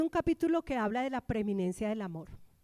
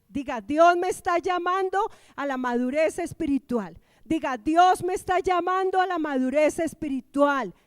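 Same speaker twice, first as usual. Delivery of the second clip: raised, emphatic call to repeat; preacher's exhortation; 4 semitones higher, 7 dB louder than usual. Real, not acted. Preacher telling the congregation to say it, emphatic exhortation.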